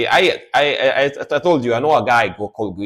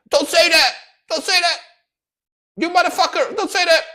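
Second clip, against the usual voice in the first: angry yelling lady; 4 dB louder than usual. Retold or imitated. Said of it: "And she was like".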